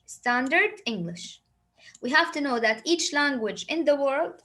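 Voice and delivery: ordinary talk, nothing marked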